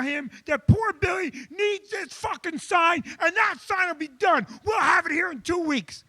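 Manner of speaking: high, shouted angry outburst; furious boss rant. Retold or imitated. Boss exploding at his sign guy in a meeting, shouting high. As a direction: explode at the employee, pitched high. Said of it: "so I'll just give it to you".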